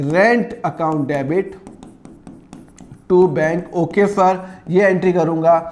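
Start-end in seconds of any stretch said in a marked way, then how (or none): 0.1-3.7 very slow, word by word, pausing before the last words; slow dictation. Shown as a demonstration. Teacher dictating the journal entry to the class, very slowly. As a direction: dictate it so students can write it down. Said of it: "क्या entry करूँगा?".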